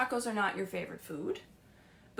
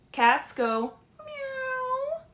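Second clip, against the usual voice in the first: high, slow cat-like meow; playful high meow; singsong meow; much slower than usual. Shown as a demonstration. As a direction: stretch it out high for the kids. Teaching animal sounds to kids.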